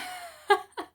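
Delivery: short, high laugh; a quick little laugh at oneself; brief giggle at your own habit; self-amused laugh. Real, not acted. Laughs at own repeated phrase.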